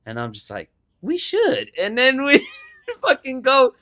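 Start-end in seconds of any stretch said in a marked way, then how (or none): 1.0-1.7 eager. Retold or imitated. Buddy pitch, mocked eager reply. 1.8-3.7 laughing. Real, not acted.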